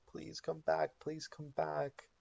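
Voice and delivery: high, fading mock sob; sobbing plea. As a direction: beg softly over the body, half crying. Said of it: "he is sobbing into Walter's body".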